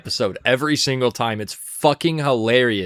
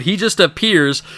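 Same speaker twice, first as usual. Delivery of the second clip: ordinary delivery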